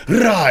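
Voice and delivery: sudden high, loud battle yell; battle cry. Retold or imitated. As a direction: roar it like a swordsman striking. Acted Zoro battle cry.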